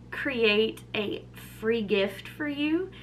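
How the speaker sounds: usual speaking voice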